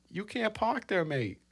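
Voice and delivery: high-pitched mock British accent; British accent with a lifted, singsong "park". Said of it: "I have sort of an accent".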